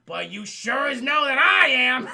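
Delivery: loud, high-pitched dramatic boast; hammed-up declaration. Eliza declaring herself ready, emphatically hammed up. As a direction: belt it out to a big finish. Eliza's big closing line, retold.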